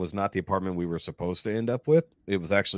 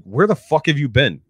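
louder, higher mock yell; retold yelling; 7 semitones higher, 7 dB louder than usual. Manager confronts the worker who walked off, yelling. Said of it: "starts yelling at me by name".

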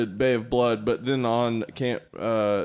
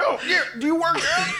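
high teasing flirt; mock flirty voice. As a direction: coo it like a smitten fan.

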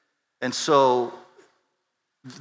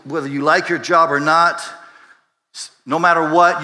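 ordinary delivery

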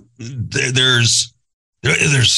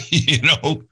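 ordinary talk

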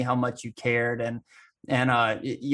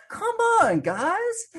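much higher, pleading whine; whiny plea; 3 dB louder, much slower than usual. Retold or imitated. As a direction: beg the guys to let you in, high and whiny. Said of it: "And I'm like".

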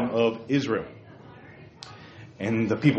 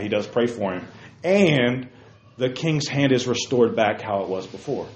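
ordinary talk

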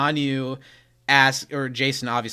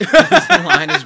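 real laughter, higher and louder; laughing; 13 dB louder than usual. Real, not acted. Host cracking up about a funny line. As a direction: break up laughing mid-sentence.

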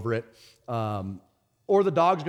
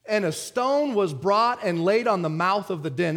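slow, higher-pitched preacher's reading; scripture reading; 6 semitones higher, 3 dB louder than usual. Acted. Preacher reads Daniel six to congregation, scripture reading. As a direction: read the verse out with measured weight.

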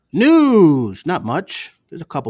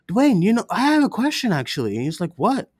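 high, wide-eyed naive cousin voice, pitched way up; cousin's question; 10 semitones higher, 3 dB louder than usual. Retold or imitated. Cousin at Christmas dinner asking Dwayne about Deadpool, high naive voice. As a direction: play the clueless cousin asking innocently.

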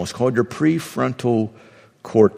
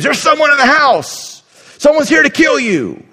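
high, loud panicked screaming; mock panic from the twitchy amygdala; 14 semitones higher, 5 dB louder than usual. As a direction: shriek it like there's an intruder. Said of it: "your amygdala screams".

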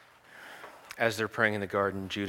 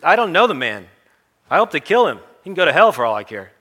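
loud, higher, heated outburst; angry denial; 7 semitones higher, 12 dB louder than usual. Acted. Peter cornered by strangers at the fire, angry shout. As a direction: spit the denial out in panic.

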